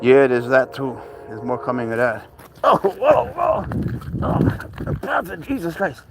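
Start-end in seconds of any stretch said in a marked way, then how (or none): 2.6-6.0 sudden high-pitched whoa of surprise; startled whoa. Real, not acted. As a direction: yelp as the cat flies at you. Cat leaps in full circle.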